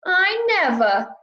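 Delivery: high, slow proud huff. Acted. North-going Zacks huffing with pride at the other Zacks. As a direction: puff up your chest and huff it. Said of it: "puffed up his chest with pride".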